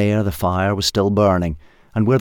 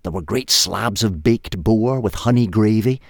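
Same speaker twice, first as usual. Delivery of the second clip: ordinary delivery, nothing marked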